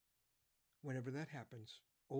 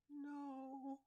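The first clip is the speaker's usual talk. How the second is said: put-on very high, soft child's voice; a small lost boy answering; lost boy telling the cop no; little boy; 13 semitones higher, 3 dB quieter than usual.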